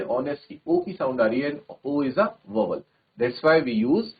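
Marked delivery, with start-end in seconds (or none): none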